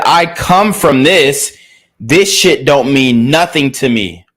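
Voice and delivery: loud slow retold quote. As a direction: hammer home his street attitude, slowly. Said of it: "He said".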